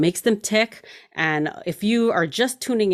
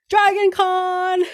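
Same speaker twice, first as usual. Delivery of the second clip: excited squeal; 11 semitones higher, 4 dB louder, slower than usual. Real, not acted. Excited host says the event's name.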